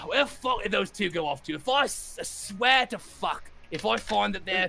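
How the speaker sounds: put-on northern English accent, ranting about two missing goblins. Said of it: "meant to be more like from the north".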